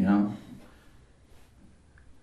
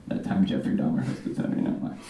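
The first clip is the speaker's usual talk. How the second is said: quick, much softer, lower repeat; quiet fast repeat; 9 semitones lower, 8 dB quieter, much faster than usual. Shown as a demonstration. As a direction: mutter the title fast. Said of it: "repeats the title".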